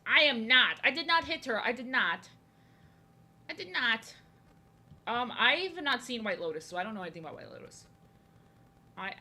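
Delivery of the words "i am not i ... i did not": high, emphatic hammed-up protest; overacted indignant insistence; mock dramatic denial. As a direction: deny it with melodramatic outrage. Mock dramatic denial, quoting film line.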